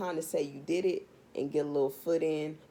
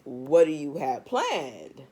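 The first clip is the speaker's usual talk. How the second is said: louder and slow, every word hit; slow, loud demand; 2 semitones lower, 6 dB louder, much slower than usual. Real, not acted. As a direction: drag it out, loud and pointed.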